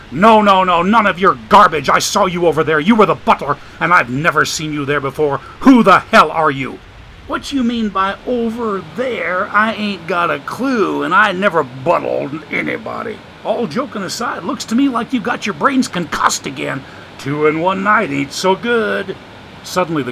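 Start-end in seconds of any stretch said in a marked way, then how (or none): 0.1-6.8 loud, accusing demand to know who he is; angry demand. Acted. Dagan loudly confronting the stranger, furious. 7.3-19.1 put-on higher, sly voice of an old drifter; streetwise homeless man. Joshua's voice in the story.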